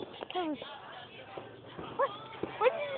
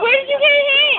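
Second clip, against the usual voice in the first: raised, worried question; alarmed; 6 semitones higher, 7 dB louder than usual. Real, not acted. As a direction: ask in real alarm. Friend hit by firework.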